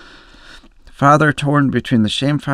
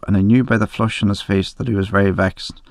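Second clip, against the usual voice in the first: usual speaking voice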